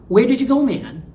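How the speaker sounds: loud, high accusing Where did you go man; reproachful question. Shown as a demonstration. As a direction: call them out for leaving. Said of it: "you went somewhere when I wasn't expecting".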